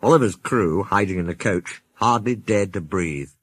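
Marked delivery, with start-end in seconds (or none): none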